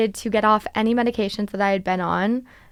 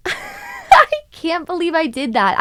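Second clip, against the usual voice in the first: high, loud laughing cry of disbelief; 8 semitones higher, 4 dB louder than usual. Real, not acted. Podcaster laughing at her younger self, loud high disbelief.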